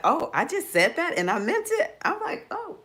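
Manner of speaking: high, laughing, brassy mock swagger; sassy inner voice. Her Aries self daring anyone to mess with her, high and laughing. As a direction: push it up high, then laugh through baby.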